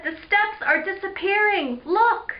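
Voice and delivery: high-pitched alarmed cry. Acted. Julie alarmed as steps vanish.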